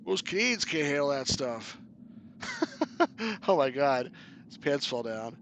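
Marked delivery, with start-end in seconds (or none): 1.8-3.4 high laugh. Real, not acted. Laugh at pants falling.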